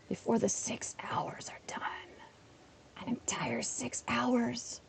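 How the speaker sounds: soft hushed afterthought; a breathy private joke; whispered aside. Real, not acted. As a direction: whisper it under your breath.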